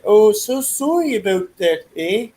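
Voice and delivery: high Canadian accent apology; a hammed-up Canadian sorry. Shown as a demonstration. Asking a friend if Canadians talk so, high Canadian accent. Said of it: "do they actually talk in that voice".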